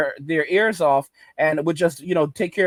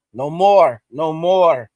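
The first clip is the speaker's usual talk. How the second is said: loud, falling "No more" twice; firm mock declaration; 6 dB louder, much slower than usual. A man imitated telling women he's done, loud. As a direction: slam the door shut with each "No more".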